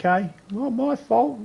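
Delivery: very high put-on voice, dismissive; defensive shrug. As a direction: squeak it off as not your problem. Acted dismissive reply in skit.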